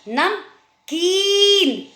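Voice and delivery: "नमकीन" slowly, "नम", pause, high drawn-out "कीन"; long ee. Shown as a demonstration. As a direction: stretch the ee in "नमकीन". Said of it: "ई की sound ज़्यादा".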